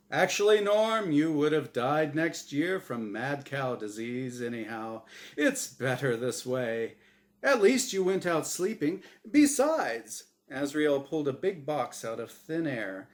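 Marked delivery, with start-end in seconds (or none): 0.1-10.2 glib host. Acted. Host breezily consoling Norm, glib cheer. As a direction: shrug it off cheerfully.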